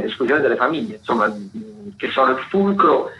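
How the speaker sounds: ordinary talk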